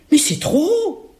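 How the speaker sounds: put-on high child's complaint; protesting little girl. Little girl below the window, high protesting voice.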